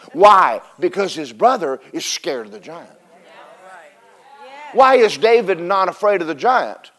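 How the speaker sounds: ordinary delivery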